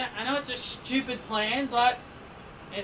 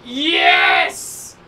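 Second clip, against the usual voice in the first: huge, loud burst of joy; triumphant shout; 11 dB louder than usual. Real, not acted. Gamer winning against the odds, triumphant shout. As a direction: explode with relief at the win. Sudden unexpected win.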